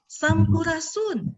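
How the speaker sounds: high, slow greeting called out; calling out greeting. Real, not acted. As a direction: draw out Sampurasun to the crowd. Greets the crowd in Sundanese.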